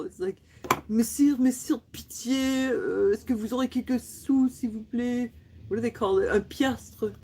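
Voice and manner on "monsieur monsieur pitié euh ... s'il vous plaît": put-on pleading old-fashioned French beggar voice; medieval beggar. Pretend panhandler begging a gentleman for coins, medieval style. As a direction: beg like a medieval peasant. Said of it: "it sounds medieval".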